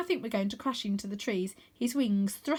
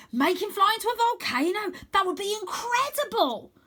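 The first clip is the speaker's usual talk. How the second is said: very high eager pleading; eager urging; 12 semitones higher, 5 dB louder than usual. Acted. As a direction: egg him on. Reckless suggestions.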